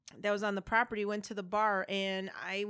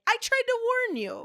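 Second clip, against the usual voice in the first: much higher and louder, gleeful told-you-so; mock told-you-so; 14 semitones higher, 9 dB louder than usual. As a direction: sing it out, teasing the guy who ignored her. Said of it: "And I'm like".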